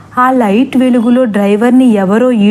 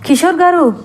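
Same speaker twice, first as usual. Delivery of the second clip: mild mock shout; 5 semitones higher than usual. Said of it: "గట్టిగా అరుస్తున్నారు".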